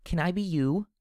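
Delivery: put-on very high, childlike creature question; creepy filly. A narrator's reading. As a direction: ask it in an eerie little foal's voice. Creepy filly robot speaks.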